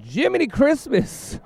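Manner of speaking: high, drawn-out mock exclamation; coach's exclamation. Player imitates coach reacting to his strikeouts. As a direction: imitate the coach's old-timey surprise. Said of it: "he said".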